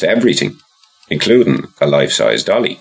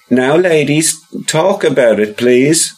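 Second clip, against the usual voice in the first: nervous shopkeeper; 11 semitones higher than usual. Acted. Donald pleads, scared.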